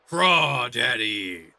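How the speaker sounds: slow booming villain call; booming demon lord. Acted.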